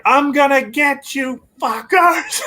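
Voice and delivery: high, building vengeful shout; imitated yell. Retelling the doll losing his hand, high mock-furious yell. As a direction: yell it as a threat, pitch climbing. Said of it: "what he yells when he".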